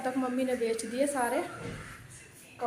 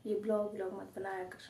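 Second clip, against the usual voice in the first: speaking softly; 12 dB quieter than usual. Real, not acted. Vlogger to viewers with her brother at home, hushed. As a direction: keep it down, like someone might overhear. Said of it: "इसलिए मैं धीरे धीरे बोल रही हूँ।".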